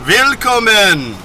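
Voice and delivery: a slow, loud, high German welcome; attempted German accent. Shown as a demonstration. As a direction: greet in a rough German accent. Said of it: "my German accent is not great".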